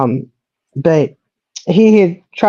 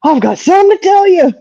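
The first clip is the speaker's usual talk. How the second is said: put-on high, eager voice of a young son; excited kid; 10 semitones higher than usual. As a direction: bubble over like a boy with big news. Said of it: "he was so excited".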